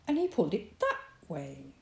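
plain reading voice

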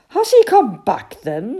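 loud, high, eager old badger; excited. A narrator's reading. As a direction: burst out with an eager question. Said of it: "said old Badger excitedly".

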